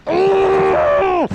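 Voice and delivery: high, loud whoop of joy; real yell of triumph; excited shout. Real, not acted. Angler landing the biggest fish, real excited shout.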